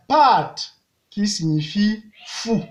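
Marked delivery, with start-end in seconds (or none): 0.1-0.7 "Pat" starting high and loud, gliding down; falling tone. Shown as a demonstration. Teacher shows the descendant tone, falling "Pat". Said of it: "Ton descendant".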